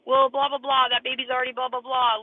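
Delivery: loud, high, mocking blah-blah voice; mocking critics. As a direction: mimic the nagging crowd with scorn. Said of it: "And then everybody's like".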